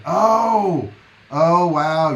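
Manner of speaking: loud, high burst of surprise; surprised oh wow. Real, not acted. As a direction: react like the news just landed. Viewer reveals he's from New York.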